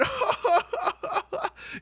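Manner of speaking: high staccato mock cackle; mock Alexa laugh. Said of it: "she just bursts out".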